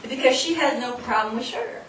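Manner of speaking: laughing. Real, not acted. Laughing while explaining to audience.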